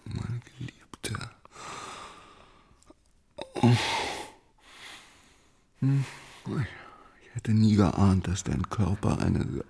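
soft intimate whisper of love; queen whispering devotion to her lover; tender whisper. Acted. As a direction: whisper it like a promise.

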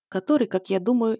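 ordinary delivery, nothing marked

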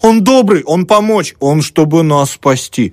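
high, urgent pleading protest; crying out. A narrator's reading. Three sisters defending Savely to the student, crying out. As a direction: cry it out high, rushing to his defence. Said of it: "закричали все три разом".

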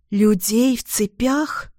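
slow, horrified question. A narrator's reading. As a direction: ask in shock, drawn out. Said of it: "ужаснулась дочь".

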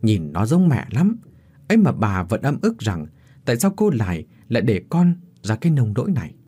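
plain reading voice

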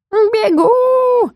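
very high, drawn-out cry; high held wail; yowling cat. A narrator's reading. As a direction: yowl it like a frantic cat. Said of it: "завопил кот".

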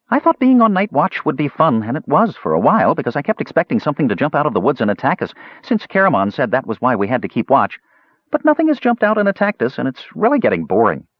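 high, fast chatter; chattering kender. Acted. Kender complains to the mage, high rapid chatter. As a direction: babble on like a bored kender.

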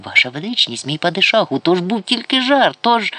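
anxious excuse, voice going up; frightened pleading. A narrator's reading. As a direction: plead with rising panic to be forgiven. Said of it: "і зашепотів".

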